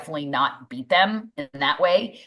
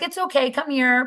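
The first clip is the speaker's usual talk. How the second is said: higher, tender mother hen; soothing mom; 8 semitones higher than usual. Retold or imitated. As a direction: coax a crying kid gently. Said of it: "I'm always like".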